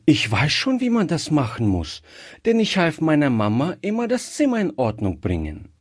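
put-on high, quick child's voice; confident little girl. A narrator's reading. Gretchen assuring him she knows how to dust, quick high child voice. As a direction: rattle it off high like an eager child. Child figure in read story.

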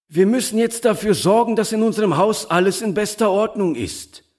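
high-pitched, eager announcement; lively Herr Moriani. A narrator's reading. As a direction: speak up high as the busy host. (lebhafter Herr Moriani, mit hoher Stimme)

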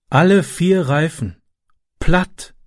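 a stranded driver's dismay, slowly; dejected. A narrator's reading. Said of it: "sagte er niedergeschlagen". (niedergeschlagen und langsam)

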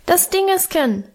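put-on high-pitched child's peep; piping little boy. A narrator's reading. Little Hermann repeats his wish, piping high. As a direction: pipe it up high like a toddler. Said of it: "piepste Hermann".

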